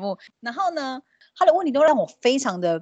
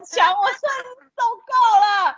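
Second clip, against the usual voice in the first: laughing exasperation; 11 semitones higher than usual. Real, not acted. Fed up amid laughter.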